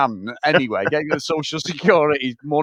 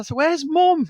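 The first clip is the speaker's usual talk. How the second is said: much higher, lightly voiced kid asking innocently; a teenager innocently asking where Mum is; mock kid voice; 12 semitones higher, slower than usual. Kids asking in retold scene.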